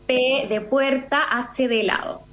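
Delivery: slow, careful letter-by-letter spelling; over enunciating. Shown as a demonstration. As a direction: spell it out slowly and clearly. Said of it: "lo voy deletreando así".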